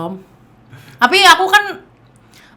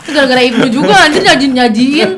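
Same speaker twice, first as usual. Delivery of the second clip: laughing; 2 semitones higher, 7 dB louder than usual. Real, not acted. Blaming friend while laughing.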